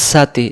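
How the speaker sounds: সাতি opened with a sharp hissed s; wrong sin sound. Shown as a demonstration. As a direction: say সাতি like "saa-tee". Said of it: "তখন এটা সিন হয়ে যাবে".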